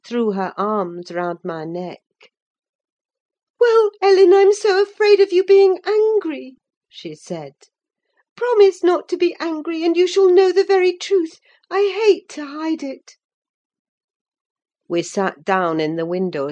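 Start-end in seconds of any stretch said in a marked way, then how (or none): 3.6-6.6 put-on very high, loud girlish outburst; tearful pleading. A narrator's reading. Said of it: "bursting into tears". 8.4-13.2 put-on high, louder girlish plea; tearful pleading. A narrator's reading. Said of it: "bursting into tears".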